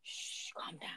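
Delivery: real whispered shush; hushed shushing. Real, not acted. Podcaster hushing a distracting cousin mid-recording, whispered. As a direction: whisper it softly to settle someone down.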